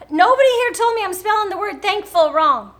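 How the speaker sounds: blaming the viewers in fun; mock indignant outburst. Real, not acted. Vlogger to her viewers after misspelling thankful, loud and high. Vlogger notices spelling error.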